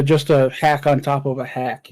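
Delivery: ordinary talk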